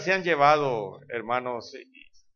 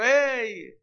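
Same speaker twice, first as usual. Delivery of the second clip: calling out; 11 semitones higher, 3 dB louder than usual. Retold or imitated. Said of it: "el hermano gritándole".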